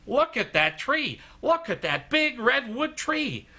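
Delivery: high, drawn-out exclamations of wonder; mock awestruck. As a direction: imitate a friend gushing over the sight. Imitating Steve marvelling at the trees.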